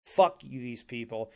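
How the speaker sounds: ordinary talk, nothing marked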